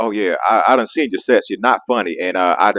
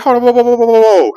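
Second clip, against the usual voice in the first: retold startled protest; 12 semitones higher than usual. Stopping Greg's accusation.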